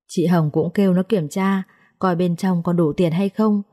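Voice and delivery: ordinary delivery, nothing marked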